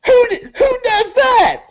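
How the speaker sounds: high, outraged screech; crazed high-pitched indignation; freaked-out crackhead. Acted. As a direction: squawk it like a startled crackhead. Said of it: "And he's like".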